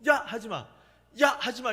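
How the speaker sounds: a playful mock scolding between siblings; children copying the parent's yelling, playfully done; kids yelling at each other. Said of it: "이러는 거예요".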